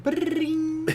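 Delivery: high sung phone ring. Retold or imitated. Imitating the phone ringing again, high sung ring. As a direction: sing the ringtone. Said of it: "the phone rings again".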